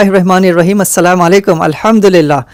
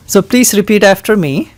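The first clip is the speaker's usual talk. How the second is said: ordinary talk, nothing marked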